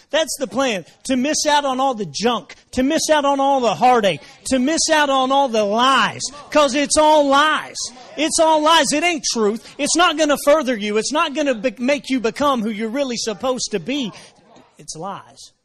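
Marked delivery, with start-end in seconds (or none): 0.1-8.9 builds from emphatic to full shouting, high and loud; rising preacher shout. Real, not acted. 8.9-14.1 forceful preaching. Real, not acted.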